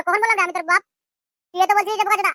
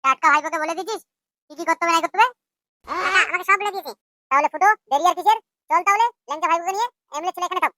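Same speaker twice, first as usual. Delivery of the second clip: usual speaking voice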